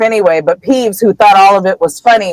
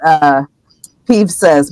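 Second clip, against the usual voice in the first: usual speaking voice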